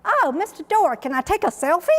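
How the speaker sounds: bright, bold request. Retold or imitated. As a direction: sweetly ask a billionaire for a selfie. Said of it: "she was brave enough to say".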